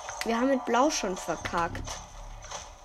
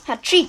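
sneezing; 5 semitones higher, 11 dB louder than usual. Real, not acted. Sneeze then thanks himself.

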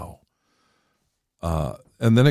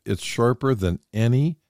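"any" lifted high with a rising tune; stress on any; 4 semitones higher than usual. Shown as a demonstration. Reading Hebrews aloud to his co-host, pressing on "any". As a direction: lean hard into "any" and let it rise. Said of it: "I want to emphasize the word any".

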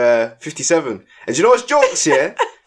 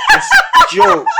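much higher and louder, breaking into laughter; laughing; 7 dB louder than usual. Real, not acted. Telling a friend about the running kids, laughing hard. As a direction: squeal it through a laugh. Cracking up telling gym story.

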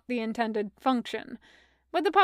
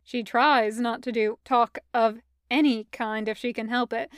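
plain reading voice

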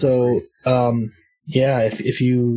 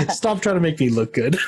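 laughing; 8 semitones higher than usual. Real, not acted. Laughing at the GM's kindness.

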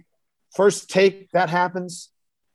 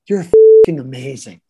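louder, emphatic rave; excited praise; 3 semitones lower, 9 dB louder than usual. Retold or imitated. Jared gushing to him after the take, loud and emphatic. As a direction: burst out with admiration.

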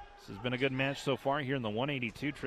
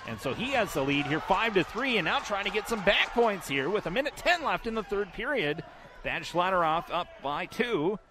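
excited; 7 semitones higher, 6 dB louder than usual. Real, not acted. Close match, final minute.

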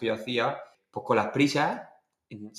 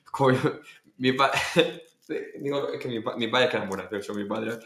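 normal talking voice

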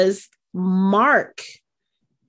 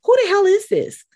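confused mom; 12 semitones higher than usual. Retold or imitated. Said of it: "she's probably like".

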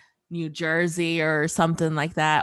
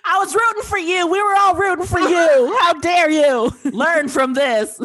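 raised, high mock-furious yell; acted angry rant; 6 semitones higher, 4 dB louder than usual. Friend reads a yelling rant for laughs, mock fury. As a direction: build a scolding into an outraged yell.

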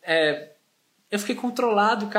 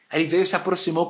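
normal talking voice

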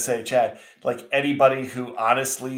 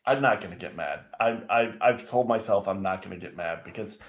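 restrained; 5 semitones lower, 5 dB quieter than usual. Real, not acted. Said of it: "I am getting mad".

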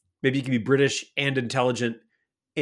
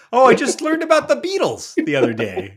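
high, loud, laughing mimicry; hammed-up British girl through laughter; mock British girl; 7 semitones higher, 6 dB louder than usual. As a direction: send up the British girl, pitched way up. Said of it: "The British girl was like".

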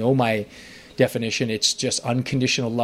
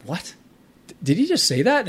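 stunned, pitch leaping much higher on the second line; astonished disbelief; 9 semitones higher, 4 dB louder than usual. Retold or imitated. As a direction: gasp it out in disbelief, then rise high and loud. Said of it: "I'm like".

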